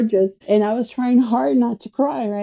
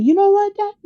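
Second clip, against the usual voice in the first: retold outburst, high-pitched and louder; mad at Dad; 12 semitones higher, 5 dB louder than usual. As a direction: snap back at your father in a raised voice. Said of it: "I got so mad".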